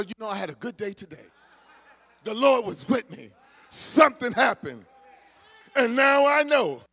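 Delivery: rising into a joyful, loud testimony; mock amazed spouse. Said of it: "they say".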